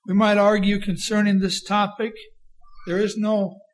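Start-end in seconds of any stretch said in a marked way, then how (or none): none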